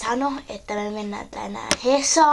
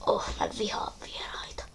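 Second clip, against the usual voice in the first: hushed aside under the breath; whispered grumble; 2 semitones lower, 13 dB quieter than usual. Acted. Grumbling to oneself about visitors, whispered. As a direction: whisper your dislike, keep it very soft.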